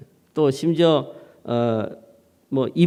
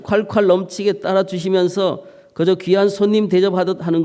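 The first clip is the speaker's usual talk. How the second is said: North Korean dialect, lively and hammed up; North Korean dialect reading; 3 semitones higher than usual. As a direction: tell it like an animated northern elder. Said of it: "이북 사투리입니다".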